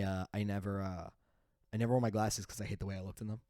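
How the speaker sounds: normal talking voice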